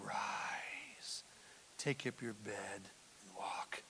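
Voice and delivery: soft, low whispered command; hushed command. Retold or imitated. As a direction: breathe it gently to the man. Jesus voiced tenderly.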